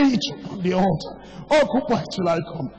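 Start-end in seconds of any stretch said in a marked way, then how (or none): none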